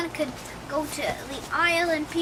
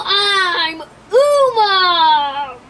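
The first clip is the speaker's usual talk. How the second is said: loud, drawn-out bellowing announcement; villain's shouted entrance; 7 dB louder, much slower than usual. Pretend. Uma bursting onto the bridge, bellowing loud. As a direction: roar it like a sea monster arriving.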